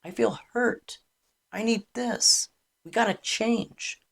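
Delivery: candid, exposed plea in short phrases; vulnerable honesty. Shown as a demonstration. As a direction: say it openly, without sarcasm. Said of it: "rather than being vulnerable".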